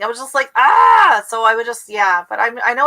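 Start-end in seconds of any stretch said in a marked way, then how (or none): 0.6-1.2 high, loud "ah" of frustration; frustrated cry. Retold or imitated. Retelling trying to watch a frustrating show, exasperated yell. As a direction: let out a mock exasperated cry. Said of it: "It was just frustrating".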